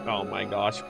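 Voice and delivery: low, soft murmur of awe; hushed reaction. Real, not acted. Reader reacting to the touching backstory, hushed and moved. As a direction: drop right down, moved by what was just read. Moved by the lore just read.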